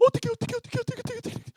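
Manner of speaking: very high, frantic repeated squeal; udawana panika. Acted. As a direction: panic in a squeaky, put-on voice. Acted panic, repeating what to do.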